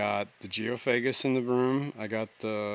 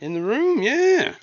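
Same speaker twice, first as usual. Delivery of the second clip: excited; 13 semitones higher, 7 dB louder, much slower than usual. Real, not acted. Excited agreement, high pitch.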